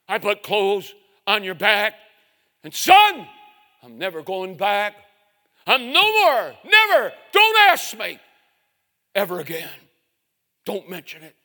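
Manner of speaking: loud, rising to a high roar; stern voice. Retold or imitated. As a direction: lay down the law. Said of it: "a stern voice".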